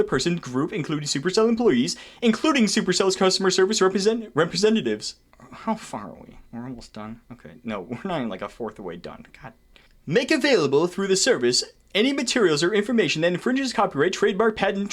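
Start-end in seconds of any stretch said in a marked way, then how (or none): 5.5-9.5 much lower, much softer mutter; tired grumble to himself; weary aside. Real, not acted. Weary aside about progress.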